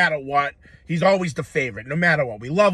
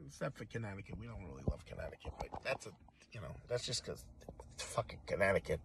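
muttered aside; 8 semitones lower, 18 dB quieter than usual. Acted. Muttered dig at Connecticut.

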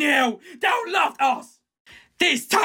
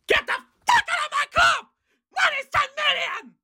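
a sudden, much higher shout of rage; furious shout; 13 semitones higher than usual. Real, not acted. Fan to a player he's axing, furious shout. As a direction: yell it at the player like he's finished. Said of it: "I'm so sorry for being angry".

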